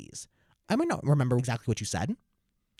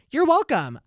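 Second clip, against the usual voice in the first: put-on loud, very high kid's cry; mock triumphant call; 8 dB louder, slower than usual. Kids springing their punchline on teacher, mock triumphant call. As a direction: imitate gleeful pupils calling out.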